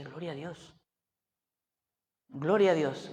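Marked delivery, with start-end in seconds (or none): 0.0-0.7 very soft, under the voice; hushed retold reaction. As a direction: say it quietly, almost to yourself. Retold private reaction to wife.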